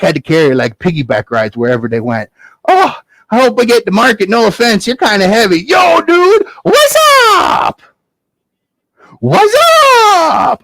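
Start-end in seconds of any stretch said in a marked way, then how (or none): 2.7-5.6 put-on high boy voice, burdened; Jack straining. Jack carrying cow. 5.6-10.6 very high, loud yo dude greeting; whacky dude shouting hello; cool stranger dude. Acted.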